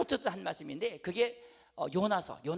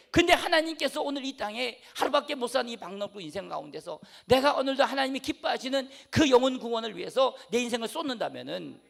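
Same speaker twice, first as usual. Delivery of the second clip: preaching; 2 semitones higher, 5 dB louder than usual. Acted. Preaching at full force.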